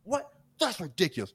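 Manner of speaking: high, hammed-up indignant "What?"; feigned outrage. Imitating the customer pretending to be outraged at the result. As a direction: play a bad actor faking shock. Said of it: "actor of the year".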